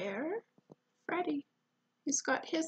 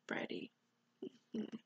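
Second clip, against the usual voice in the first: usual speaking voice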